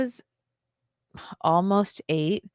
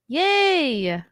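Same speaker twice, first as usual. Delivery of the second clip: excited cheer; 9 semitones higher, 6 dB louder than usual. Real, not acted. Cheering at good news.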